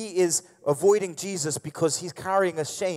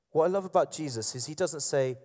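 normal talking voice

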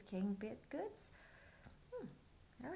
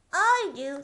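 eager page cry; 4 semitones higher, 14 dB louder than usual. Acted. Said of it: "said the page".

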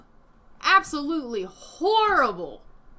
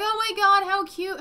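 high, loud gush of delight; acted excited cooing; 8 semitones higher, 6 dB louder than usual. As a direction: squeal over something adorable. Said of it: "I usually, I'm like".

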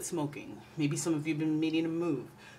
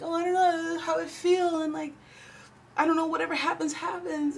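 much higher, dreamy and careless; airy water-sign voice; 12 semitones higher than usual. Retold or imitated. Imitating a drifting water sign who shrugs off plans. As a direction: float it up high like you don't care. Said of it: "as a water sign, it could be like".

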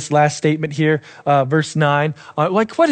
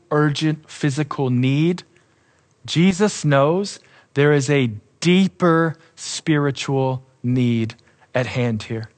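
ordinary talk, nothing marked

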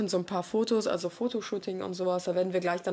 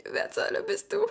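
mock bewildered; 11 semitones higher than usual. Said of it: "weil die denken".

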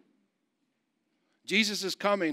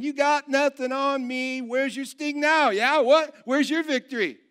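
graveyard trash talk; 8 semitones higher, 5 dB louder than usual. Acted. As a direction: crow over a beaten enemy, calling out high and loud. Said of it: "parading around in a graveyard going".

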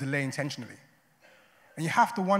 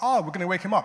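higher, louder naive eagerness; raised, upbeat quoted reply; eager mock disciples; 5 semitones higher, 7 dB louder, faster than usual. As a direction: pipe up keenly as the clueless disciples. Acting out the disciples' eager misunderstanding.

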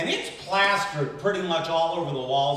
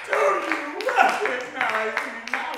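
drawn-out, high drunken yell, hammed up; screaming; 11 semitones higher, 4 dB louder, slower than usual. Drunk extra outside Tony Shalhoub's trailer, screaming for recognition. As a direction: bellow it slowly at the top of your voice.

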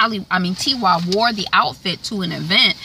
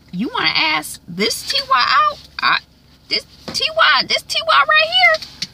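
a shrieking, pointing "this one?"; incredulous high squeal. Retold or imitated. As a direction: shoot way up high in mock disbelief. Sister incredulous, acted quote.

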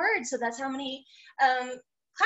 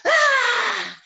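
high, loud "Ah!" yell; demonstrated yell; 8 semitones higher, 8 dB louder than usual. Shown as a demonstration. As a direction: let out a big open yell.

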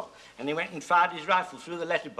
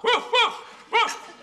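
high yappy mock woofs, pitched well up; imitating a dog bark; 4 dB louder, much slower than usual. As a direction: bark like a German dog, for laughs. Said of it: "this dog started to bark".

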